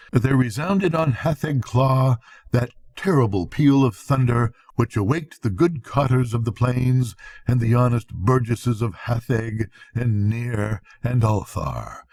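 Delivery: plain reading voice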